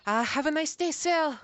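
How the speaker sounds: put-on a chirpy clerk in a muddled French-ish accent; mystery accent. GM voicing the museum clerk to the players, in a high, loud mixed accent. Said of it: "I don't even know what accent that was".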